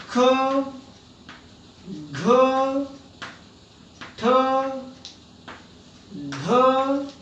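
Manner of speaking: each letter said with a strong breath; महाप्राण letters with an audible puff; aspirated letters demo. Shown as a demonstration. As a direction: force air out on every letter. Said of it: "जोर लगाना पड़ता है".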